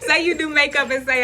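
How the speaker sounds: real laughter running into the words; laughing. Real, not acted. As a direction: restart the line while still laughing. Restarts after cracking up at herself.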